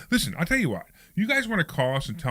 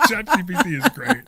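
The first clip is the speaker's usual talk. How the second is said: laughing; 5 semitones higher, 6 dB louder than usual. Real, not acted. Laughing through the line.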